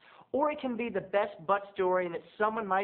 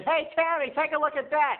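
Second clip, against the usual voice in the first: mock call out; 11 semitones higher, 6 dB louder than usual. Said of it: "and I said".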